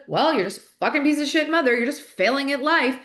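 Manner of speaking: a mother berating herself, loud and fast; harsh inner critic. Retold or imitated. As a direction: spit it out as a cruel inner voice. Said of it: "instead of".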